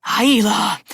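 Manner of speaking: put-on exasperated guardian scolding the girl. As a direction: call her name in weary exasperation. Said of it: "The man sounded exasperated".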